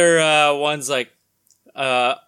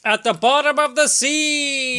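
mock announcer; 12 semitones higher, 5 dB louder than usual. Announcing comic tagline.

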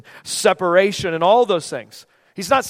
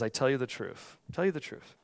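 slow, hushed scripture reading, low and soft; 8 semitones lower, 12 dB quieter than usual. Shown as a demonstration. Preacher reads verse twenty-five to the congregation, slow and soft. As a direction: read it softly, slowing towards the close. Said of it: "I'm gonna read this slowly".

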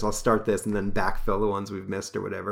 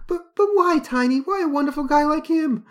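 distressed woman; 7 dB louder than usual. Acted. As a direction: shriek it like a fainting lady. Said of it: "says Lorna".

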